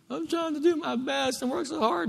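high mock-whiny self-pity; wounded complainer. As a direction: put on a hurt, high-pitched protest. Voices a wounded, criticized believer.

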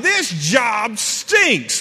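slow, loud, high mock complaint; griping worker. Preacher mocking people who quit jobs, loud slow grumble. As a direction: drag out the gripe like a sulky worker.

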